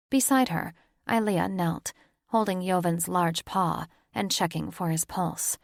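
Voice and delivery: plain reading voice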